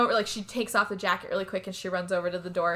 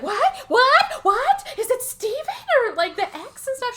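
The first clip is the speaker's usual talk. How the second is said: very high, loud hammed-up squeals of surprise; excitable mom; 6 dB louder than usual. Mom on the phone asking about the ex, high excited mock squeal. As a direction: squeal the questions like a mock-thrilled mother.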